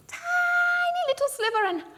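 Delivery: squeezed very high for something tiny. Acted. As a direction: make the sliver sound minuscule. Describing a tiny bite.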